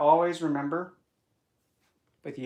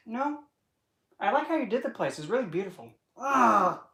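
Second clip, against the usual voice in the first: high, airy fake compliment; high syrupy fake praise; sweet mock praise; 8 semitones higher than usual. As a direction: gush over it falsely sweet. Back to sweet act.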